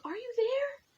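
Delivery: high calling into the clover; high call to hidden friends. A narrator's reading. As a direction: search and call into the field.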